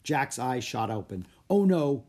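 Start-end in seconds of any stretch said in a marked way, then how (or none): 1.5-2.0 loud alarmed gasp; sudden worry. Acted. Jack alarmed waking.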